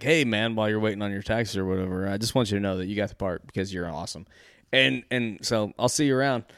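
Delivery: soft, low, understated delivery; casual, offhand. Acted. Casting boss casually tells waiting actor he got it, offhand. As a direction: deliver big news softly, like small talk.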